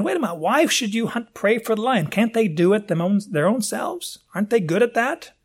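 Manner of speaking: high-pitched incredulous questioning; puzzled objection. Acted. Voices a puzzled listener's objection.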